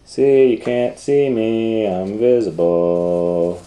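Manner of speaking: lightly voiced little boy; playful child. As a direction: tease like a hiding child. Child playing invisible.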